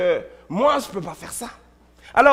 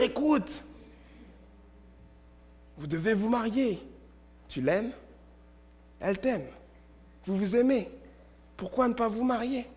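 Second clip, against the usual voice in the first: softer, coaxing inner voice; gentle voice of conscience; 12 dB quieter than usual. Acted. Inner voice urges the unmarried couple to marry, soft and coaxing. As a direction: plead softly like a kind conscience. Voicing conscience urging marriage.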